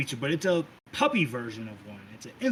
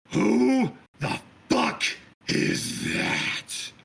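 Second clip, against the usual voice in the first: murderous rage; 6 semitones higher, much slower than usual. Acted. Sister spotting the lookalike, seething slow rage. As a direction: drag each word out in fury. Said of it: "in sheer fucking murderous rage".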